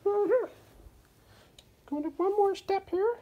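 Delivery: high, sing-song toy mouse voice; playful Mickey voice. Pretend.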